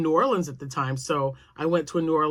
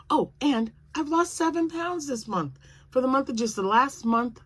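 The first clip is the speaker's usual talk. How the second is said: excited; 9 semitones higher, 3 dB louder than usual. Real, not acted. Proud weight-loss announcement.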